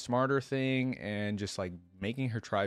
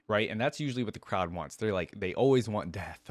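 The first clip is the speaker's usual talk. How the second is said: ordinary delivery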